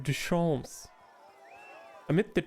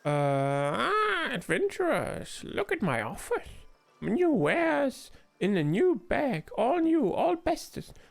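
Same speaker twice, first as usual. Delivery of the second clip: high, hammed-up broken-English hawker; a huckster bragging about his bag; pushy street trader; 8 semitones higher than usual. Trader hawking new wares to adventurers, hammed-up.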